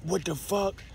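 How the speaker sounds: high, breathless panic; terrified and confused; panicked. Retold or imitated. Gun pointed at him.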